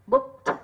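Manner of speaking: booked, the -ed sounded as t; final T in booked. Shown as a demonstration. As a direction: say booked ending in a crisp "t". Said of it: "là âm T". (đọc booked kết thúc bằng âm T)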